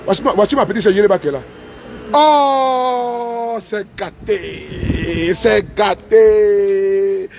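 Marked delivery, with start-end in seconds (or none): 2.1-7.3 long, drawn-out cry of delight, much slower; drawn-out cheer. Real, not acted. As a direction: stretch every syllable in a joyful shout. Cheering a supporter's gift live. (cri de joie très étiré et lent)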